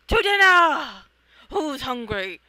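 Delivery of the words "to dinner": loud, high triumphant cry; rallying shout. Acted. Friends finishing the attack, loud cry. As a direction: belt it out to the team.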